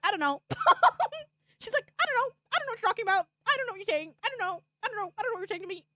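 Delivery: high, loud, rushed I don't know; mock-panicked Mion deflecting; flustered evasive girl. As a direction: play the panicky dodging character. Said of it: "And she's like".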